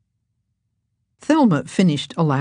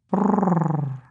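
cat purr; 2 semitones higher than usual. A narrator's reading. Cat purrs.

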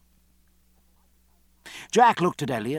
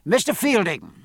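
raised call to the ship; hailing; 6 semitones higher, 3 dB louder than usual. A narrator's reading. Captain to Fielding aboard ship, hailing. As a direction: hail him by name, pitched up. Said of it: "hailing the ship from his usual point".

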